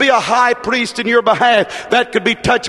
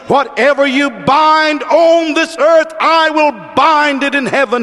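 impassioned proclamation; 4 semitones higher than usual. Retold or imitated. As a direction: proclaim the promise high and deliberate. Preacher proclaiming Jesus's promise.

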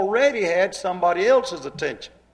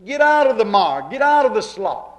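loud, higher command on "out"; preaching command; 4 semitones higher, 6 dB louder than usual. Acted. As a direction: order them out of the slop. Preacher commands, rising force.

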